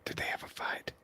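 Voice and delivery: hushed, voiceless whisper. Real, not acted. Co-host asks about a fight next door, whispering. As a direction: ask it under the breath. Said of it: "why are we whispering".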